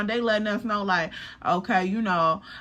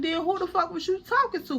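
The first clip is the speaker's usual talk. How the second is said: much higher, fast "who the fuck" demand; nosy disbelief; 9 semitones higher, faster than usual. Retold or imitated. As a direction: squeak it out in shocked nosiness. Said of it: "So I'm like".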